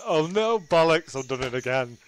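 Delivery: ordinary talk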